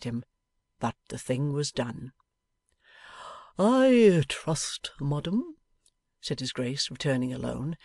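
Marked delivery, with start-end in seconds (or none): 3.6-5.6 lightly voiced Archbishop, a touch higher and louder; polite formal apology from a churchman; stately Archbishop.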